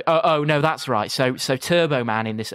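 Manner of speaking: usual speaking voice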